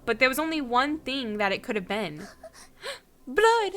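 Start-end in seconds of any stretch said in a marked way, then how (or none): 2.8-3.7 shocked gasp. Acted. Discovering blood on the knife.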